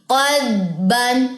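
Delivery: "क़दबन" drawn out smoothly; correct slow reading. Shown as a demonstration. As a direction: model the right way. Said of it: "vibration सी feel होगी".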